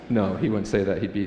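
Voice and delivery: normal talking voice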